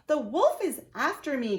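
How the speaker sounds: a hammed-up cry for help; crying out in fear. Girl running into the woodcutter, calling out in mock fright.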